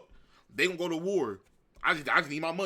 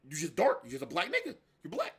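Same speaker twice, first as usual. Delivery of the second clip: ordinary delivery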